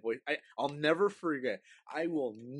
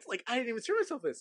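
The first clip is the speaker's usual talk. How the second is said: put-on indignant kid; 11 semitones higher than usual. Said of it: "And I was like".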